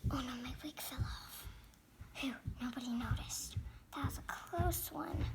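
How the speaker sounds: hushed, low whisper to oneself; whispered aside. Pretend. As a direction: whisper a secret mishap and relief. Secret mishap backstage, unnoticed.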